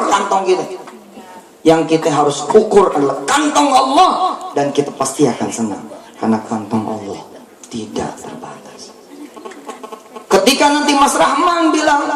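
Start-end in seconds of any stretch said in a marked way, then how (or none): 4.5-8.9 trailing off. Real, not acted. Sermon point trailing off.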